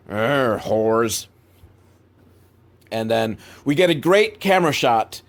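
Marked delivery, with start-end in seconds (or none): 0.1-1.3 imitated cranky old man's growl; grumbling under breath. Cranky old man grumbling at the swimmers, under his breath. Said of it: "under his breath".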